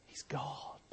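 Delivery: hushed breathy whisper of awe; awed whisper. Acted. Preacher facing Jesus in reverent awe, whispering. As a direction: whisper it, overwhelmed.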